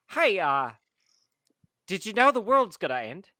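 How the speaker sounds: cultist greeting. Acted. Player acts character approaching guest.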